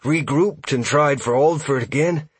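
high, anxious confession; high-pitched, pleading elf voice; shameful admission. A narrator's reading. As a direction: own up, ashamed and scared. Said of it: "Huxley admitted shamefully".

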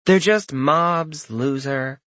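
slow contemptuous drawl. Acted. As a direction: stretch each word out mockingly. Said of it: "Junior drawled".

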